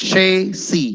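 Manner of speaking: Chassis as "SHAY-see", spaced; correct pronunciation. Shown as a demonstration. Teacher likening it to s-h-a-c-y, correct pronunciation. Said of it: "എസ് എച്ച് എ സി വൈ പോലെ".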